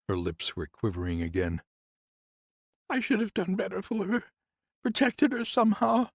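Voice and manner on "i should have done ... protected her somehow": much higher, louder woman's voice, bitter; grieving nurse. Acted. Nurse complains to Conrad about the house, higher voice. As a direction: lift into the nurse's aggrieved voice.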